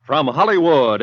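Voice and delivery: ordinary talk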